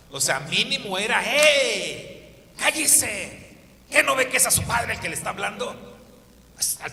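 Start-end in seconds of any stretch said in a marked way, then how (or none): 1.2-5.7 put-on loud, high, fast scolding shout of an old-school father; angry father yelling. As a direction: snap at the child like a strict father. Old-style father scolding son.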